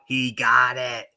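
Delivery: drawn-out mock yell; imitated yell. Recalling a colleague at the field goal, imitated yell. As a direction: stretch out a colleague's celebrating call. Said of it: "you can hear M F yell out".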